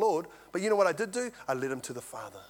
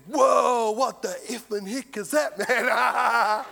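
shouted whoa, higher and louder, half laughing; startled shout; 5 semitones higher, 6 dB louder, much slower than usual. Retold or imitated. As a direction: let it burst out like a shocked bloke. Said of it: "Well, he didn't say it like that".

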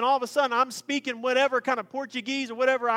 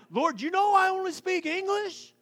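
higher, mock timid plea; pleading with God; 8 semitones higher than usual. Preacher acting himself questioning God, higher mock plea. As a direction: play the nervous servant asking. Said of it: "I should say".

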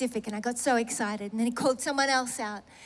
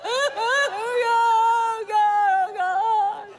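loud, high-pitched wailing to God; drawn-out, hammed-up bawling; mock sobbing; 7 semitones higher, 5 dB louder, much slower than usual. Woman re-enacting her disappointed sobs to God, mock sobbing. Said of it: "I sobbed some more".

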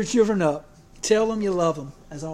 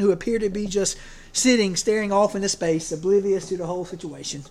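ordinary delivery

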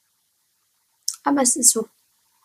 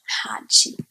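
whispered sneeze. Pretend. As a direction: breathe a hushed sneeze. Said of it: "ich muss, glaub ich, noch mal niesen". (geflüstertes Niesen)

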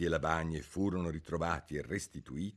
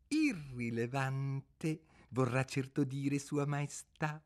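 slow, over-deferential correction; mock respect; 7 semitones higher, 3 dB quieter, slower than usual. A narrator's reading. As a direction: rabbit correcting the king with sham respect. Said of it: "ostentando grande rispetto".

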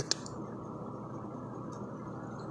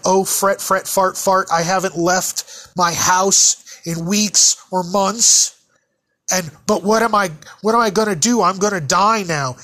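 mocking panic; 5 semitones higher, 4 dB louder than usual. Mocking a fearful person.

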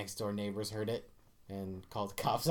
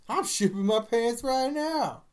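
high, loud, drawn-out announcement; mock outburst; 11 semitones higher, 7 dB louder, slower than usual. Escalating a pants pun for friends, high loud mock outburst. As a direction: belt it out slowly in a high voice.

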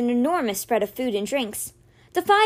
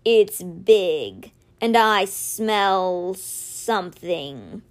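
slow, deep, watchful voice; Simber's voice; 3 semitones lower, much slower than usual. Acted. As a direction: drag each word out low like a wary beast. Simber voiced, sniffing the air.

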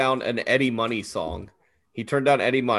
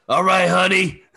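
higher, louder diner-waitress call; truck stop waitress; 6 semitones higher, 5 dB louder, slower than usual. Acted. Aunt Flo greets you in a dream, truck stop waitress voice. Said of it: "like, a truck stop diner waitress".

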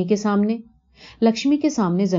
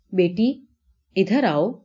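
plain reading voice

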